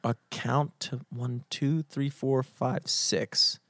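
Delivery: low, soft syllable count; tally each beat quietly. Real, not acted. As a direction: count them off softly under the breath.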